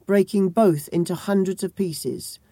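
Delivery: ordinary delivery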